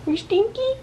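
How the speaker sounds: high, soft teasing coo; baby talk to pet. Acted. Teasing Gordo the dog in baby talk. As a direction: tease the dog sweetly.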